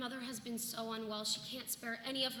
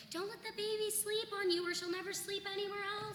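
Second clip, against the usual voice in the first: mocking busybody; 9 semitones higher than usual. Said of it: "If Goody Garlic comes around here telling me".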